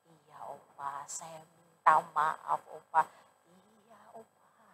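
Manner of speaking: very soft, slow, humble apology; meek and quiet. Retold or imitated. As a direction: shrink your voice and apologize slowly. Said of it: "suara saya makin pelan". (pendeta menjawab opa dengan suara pelan dan lambat)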